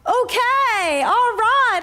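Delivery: high, drawn-out retold reaction; exaggerated high-pitched conceding; mock taken aback. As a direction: act playfully startled by his forcefulness.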